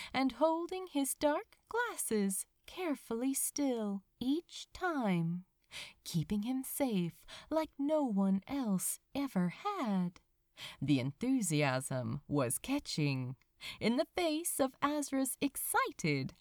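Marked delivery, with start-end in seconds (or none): none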